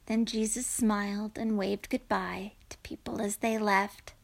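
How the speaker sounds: ordinary delivery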